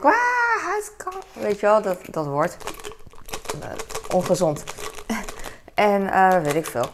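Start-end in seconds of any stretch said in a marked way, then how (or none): 0.0-1.2 high, startled gasp of a word; mock shock at the thought; mock alarm. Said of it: "denk ik".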